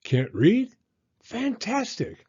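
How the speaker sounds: delighted bird. A narrator's reading. Bird thrilled Rocket cannot read, high delight. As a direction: squeal with joy at a new pupil.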